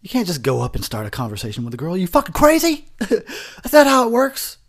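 put-on disbelieving outburst at guys hitting on girls; mock disbelief. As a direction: act out baffled amazement. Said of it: "I'm like".

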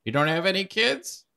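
pry kindly like a busybody aunt; nosy ghost. Acted. Ghost consoling the paladin, high and chirpy.

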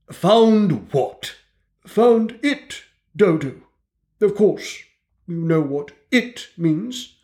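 high, slow, self-important mouse; pompous mouse lecturer. A narrator's reading. Mouse lectures the dripping animals, high and pompous. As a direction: lecture the wet animals grandly, like a tiny professor.

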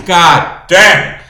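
loud, high cry of frustration; loud exasperated curse; frustrated outburst. Real, not acted. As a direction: blurt it out as the keg foams. Keg runs out, only foam.

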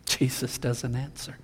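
ordinary talk

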